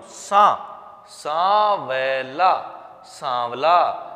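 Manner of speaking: सांवला said slowly twice, the nasal सां clear; slow, careful demo. Shown as a demonstration. Teacher asks the class to copy his pronunciation. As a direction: take सांवला slowly, syllable by syllable. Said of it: "मेरा जो pronunciation है ना, उसकी तरफ note".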